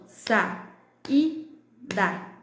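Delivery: "saída" split sa - í - da; three separate slow beats for sa, í, da; syllable by syllable. Shown as a demonstration. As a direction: clap out "saída", the í standing alone. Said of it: "bate a palminha aí das sílabas".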